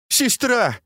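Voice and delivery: high frantic cry; shouting. Acted. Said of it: "кричала Цзиньгуй".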